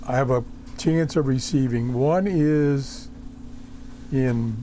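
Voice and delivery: ordinary talk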